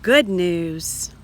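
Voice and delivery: usual speaking voice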